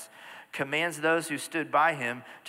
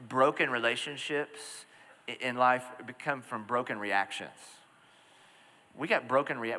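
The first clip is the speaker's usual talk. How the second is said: ordinary talk, nothing marked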